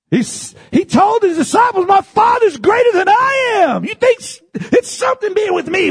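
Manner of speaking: high, loud preaching cry; fervent preaching. Acted. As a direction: lift it up with full fervor. Preaching climax continues.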